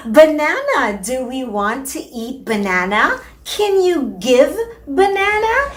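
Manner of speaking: high sing-song parentese, slowing on the last ask; parent coaxing toddler. Shown as a demonstration. Mother coaxing her toddler to hand over banana, high sing-song. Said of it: "and I'm going to say".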